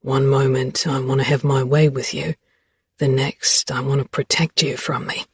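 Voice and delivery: a hint of a teasing young man; Xavier voice. A narrator's reading.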